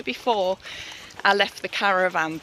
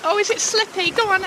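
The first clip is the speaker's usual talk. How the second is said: coaxing dog voice; 11 semitones higher, faster than usual. Real, not acted.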